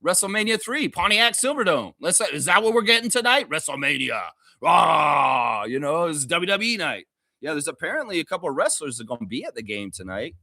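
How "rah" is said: short louder hype yell; wrestling roar. Pretend. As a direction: let out a WrestleMania battle cry.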